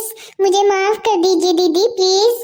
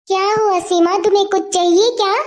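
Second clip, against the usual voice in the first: ordinary delivery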